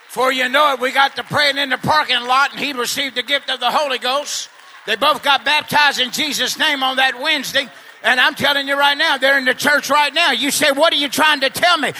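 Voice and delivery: shouted, rising gospel preaching, slowing on the big lines; preacher pounding it home to the church; preaching at full force. Acted. Preacher to the congregation, preaching at full shout.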